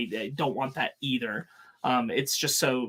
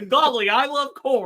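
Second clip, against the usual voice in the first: much higher and louder, hammed-up folksy Superman; mock Superman catchphrase; 10 semitones higher, 7 dB louder than usual. Cohost repeats the fake Superman catchphrase, high and hammed-up. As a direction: squeal it like a giddy farm boy hero. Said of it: "the same thing".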